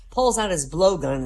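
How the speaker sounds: usual speaking voice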